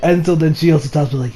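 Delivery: ordinary delivery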